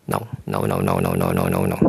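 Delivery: deep mock mom refusing everything; imitating mother. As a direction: brush it all off like a stern mom. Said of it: "itu yang nyokap gua lakukan sama gua".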